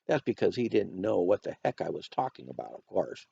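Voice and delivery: plain reading voice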